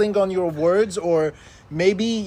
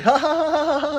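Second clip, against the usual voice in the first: high nervous laugh out of discomfort; awkward laugh; 11 semitones higher, 3 dB louder, much faster than usual. Retold or imitated. Stranger laughing along out of politeness, high and uneasy. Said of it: "the other person laughs too from discomfort".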